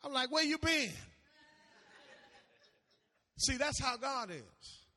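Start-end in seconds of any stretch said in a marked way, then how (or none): 0.3-0.9 high, loud comic retort; exasperated, raised-pitch outburst; mock indignation. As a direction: call out as if scolding someone who vanished. Indignant retort to returning GPS.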